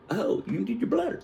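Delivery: high-pitched teasing question; mock taunt. Uncle needling his rival before the contest, mock taunt. Uncle mocks the other's empty bladder.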